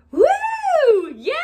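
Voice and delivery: drawn-out "woo" and a yay; mock cheering. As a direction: imitate an over-eager cheer. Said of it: "she's not a woo kind of girl".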